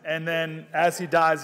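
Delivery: usual speaking voice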